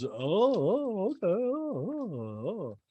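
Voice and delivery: drawn-out listening oohs, swooping down. Retold or imitated. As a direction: croon mock acknowledgments. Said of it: "He always makes a sound".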